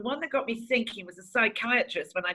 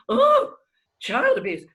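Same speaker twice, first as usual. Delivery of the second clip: high, loud "oh", then a drop; exaggerated horrified exclamation; mock shock; 13 semitones higher, 8 dB louder, slower than usual. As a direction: imitate an appalled reaction. Acts a woman's shocked reaction.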